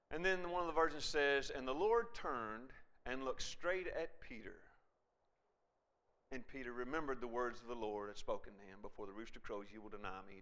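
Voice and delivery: low, soft, grave narration; hushed, sorrowful telling. A narrator's reading. Solemn telling of Peter's fall.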